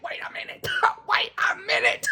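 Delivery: breathy whispered "Wait a minute"; replaying her stunned reaction in a hush; retold whisper. Telling viewers her reaction to the ending, whisper. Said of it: "I was like".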